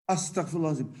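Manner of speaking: ordinary talk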